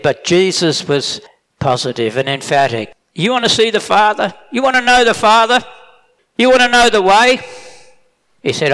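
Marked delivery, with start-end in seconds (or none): 3.1-7.4 builds higher and louder; climbing preacher's challenge, louder; rising fervent question. Acted. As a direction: press each question harder, higher each time. Jesus quoted, preaching.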